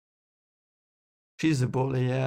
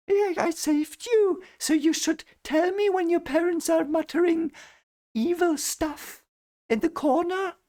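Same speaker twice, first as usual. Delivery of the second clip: much higher, slowed, sneering villain voice; mock witch queen. Reader imitating Melina manipulating the children, very high mock voice. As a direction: squeak it out like a scheming witch queen mocking the kids. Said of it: "They can tell it to the children".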